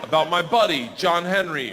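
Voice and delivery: normal talking voice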